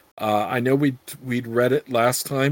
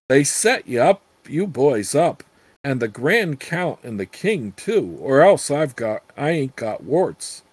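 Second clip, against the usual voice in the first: ordinary delivery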